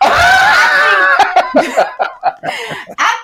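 high excited squeal with laughter; amped up. Real, not acted. Podcast guest fired up by the preaching, amped. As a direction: burst out, too worked up to continue. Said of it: "I'm getting amped".

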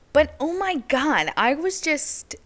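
high, loud, slow outburst; upset outburst. Real, not acted. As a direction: burst out high and loud, slowly. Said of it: "I remember being so upset".

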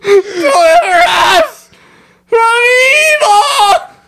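high, shrieking, wailing plea; terrified prayer. Retold or imitated. Aunt May shrieking her prayer at Green Goblin. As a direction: cry out the prayer in mock terror. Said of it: "And she's like".

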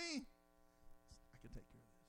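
soft, slow whisper. Acted. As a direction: breathe it out in confidence. Whispered aside voice.

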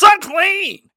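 high, loud shout of unclean. Retold or imitated. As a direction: yell it in disgust at her. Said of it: "and shouts".

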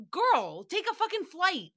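high-pitched mock exasperation; exasperated scolding. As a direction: tell her off, pitch leaping up in disbelief. Acted scolding of absent celebrity.

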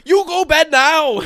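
put-on loud, high, slow caricature Chinese accent; mock Chinese accent. Comic imagining himself waking with a Chinese accent, hammed-up shouting. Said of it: "wake up with a Chinese accent".

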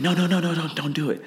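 high-pitched mock yell; imitated yelling. Friend imitated yelling a warning mid-fight, mock yell. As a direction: call out an urgent warning, only half shouted. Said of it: "this guy starts yelling".

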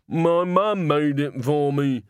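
put-on slow, childlike and earnest; a simple soul explaining his hat; slow simple Walter. A narrator's reading. Walter tells Salzella about his beret, slow and childlike.

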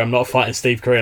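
ordinary delivery

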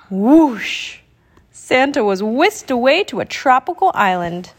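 ordinary delivery

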